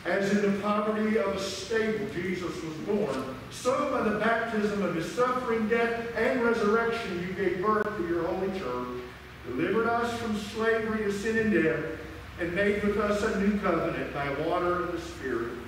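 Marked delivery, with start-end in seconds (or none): none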